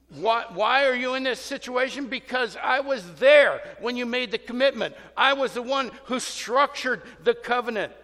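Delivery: firm divine rebuke; God's stern rebuke. Retold or imitated. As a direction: confront them as God himself.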